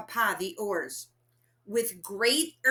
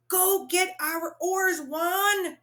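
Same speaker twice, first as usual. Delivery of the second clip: yelled; 10 semitones higher, slower than usual. A narrator's reading. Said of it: "Papa yelled".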